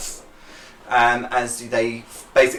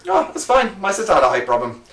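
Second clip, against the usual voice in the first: chatty local; 6 semitones higher than usual. Acted.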